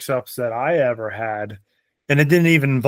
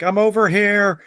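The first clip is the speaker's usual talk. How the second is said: retold yell; 6 semitones higher, slower than usual. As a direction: call out that you're over here, half in fun. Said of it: "and I'm yelling".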